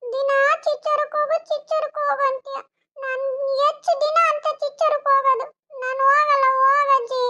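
put-on high, childish whine; whiny little boy. Little boy whining to grandma about school, high childish voice. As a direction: play a small boy refusing to go.

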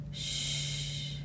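soft whispered hush; shushing. Acted. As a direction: hush him gently. Calming Rob at the cage.

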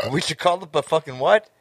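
ordinary talk, nothing marked

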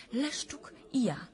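slow, breathless whisper; slow fearful whisper; frightened whisper. Acted. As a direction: whisper the names, scared. Recounting a terrifying nightmare.

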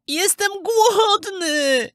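very high, drawn-out plaintive cry; hungry puppet boy calling. Acted. Hungry puppet boy calls out in the empty cottage, high and plaintive. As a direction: wail out the hunger slowly. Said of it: "zawołał chłopiec".

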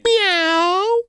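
high, drawn-out meow; cat meow. Pretend. Host meowing at the cat Kiwi, high cat meow.

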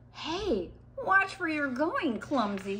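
mild narrated shout, starting high and dropping; storybook shout. A narrator's reading. Sid to the creature that knocked the egg, mild shout. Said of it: "shouted Sid".

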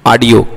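"ऑडियो" as "aadio", first vowel an open "aa"; mispronounced audio. Shown as a demonstration. Teacher repeating what many students say, "ऑडियो" as "aadio". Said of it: "आप बहुत लोग बोलते हैं".

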